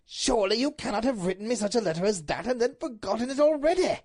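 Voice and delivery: impassioned husband's outburst; incredulous Duke. Acted. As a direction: reproach her in disbelief. Duke protesting to wife.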